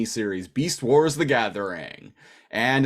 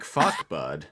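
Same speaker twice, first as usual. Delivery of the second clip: retold Canadian swear; 7 semitones lower than usual. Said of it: "Eastern Canadian".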